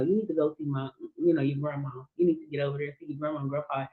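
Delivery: softly urging, voice dropped low; hushed and earnest, nudging her along; quiet urging. Retold or imitated. Telling her niece to go see her grandparents, softly.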